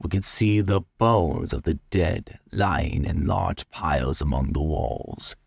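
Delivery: usual speaking voice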